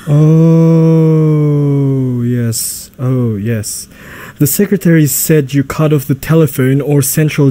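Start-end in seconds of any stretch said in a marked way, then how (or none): 0.1-2.2 long drawn-out, sustained realisation; dawning recognition. Acted. Butler finally placing the visitor's face, drawn-out recognition. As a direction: stretch it out as it sinks in.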